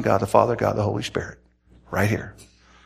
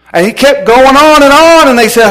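worked-up shouting; 11 semitones higher, 9 dB louder than usual. Retold or imitated. Preacher recounts the screaming at full force.

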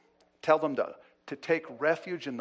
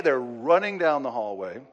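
ordinary talk, nothing marked

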